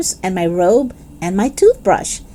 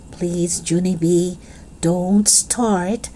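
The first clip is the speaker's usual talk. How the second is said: quiet warning; 4 semitones lower, 6 dB quieter, much slower than usual. Acted. Mother warns daughter quietly.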